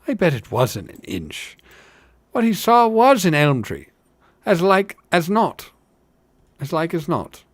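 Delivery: dismissive village fellow, climbing in pitch; sceptical hobbit. Acted. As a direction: scoff like a tavern regular.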